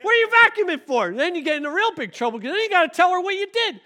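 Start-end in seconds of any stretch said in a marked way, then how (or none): 0.0-1.1 loud, high, exasperated mother; mock-scold like an angry mom. Mom scolding her son for vacuuming, loud and high. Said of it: "She'd be like".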